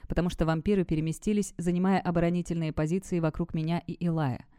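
ordinary delivery

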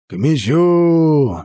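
slow, stretched call to arms; shouted command. Acted. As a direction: yell it to the ranks. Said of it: "заорал Олаф".

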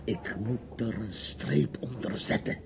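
whisper. Real, not acted. Confessing resolve quietly.

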